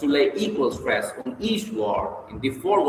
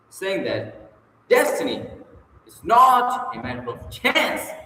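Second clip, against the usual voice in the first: "not" lifted much higher and slowed; emphasis on not; 9 semitones higher than usual. Shown as a demonstration. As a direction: stress "not" and "chance", slowly. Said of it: "If you wish to bring out the thought".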